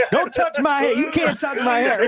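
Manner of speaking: high, laughing mock voice. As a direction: squeal it through laughter. Mock quote while laughing.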